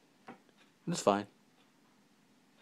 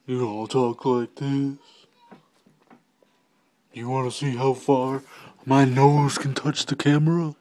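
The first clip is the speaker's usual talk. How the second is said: hammed-up mimicking voice, building louder; imitation of an imitation; 2 semitones higher, 5 dB louder than usual. Vlogger mimicking someone's take on him, hammed-up imitation. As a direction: put on a mocking voice and push it louder. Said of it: "my imitation of your imitation of me".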